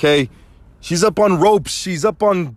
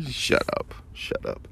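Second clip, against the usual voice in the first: whispered shut up; 11 dB quieter than usual. Real, not acted. Reacting to the slogan.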